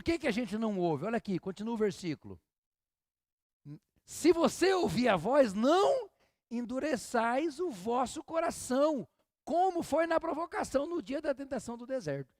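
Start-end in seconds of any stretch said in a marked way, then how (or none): 4.0-12.3 scripture reading. Acted. Reads the verse aloud.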